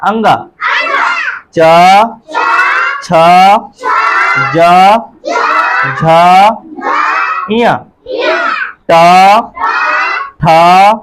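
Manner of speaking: loud teacher call of each consonant; loud drill call. Shown as a demonstration. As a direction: shout every syllable for the class to echo. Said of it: "हमारे यहाँ पर क्या बोलेंगे".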